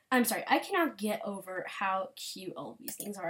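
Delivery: usual speaking voice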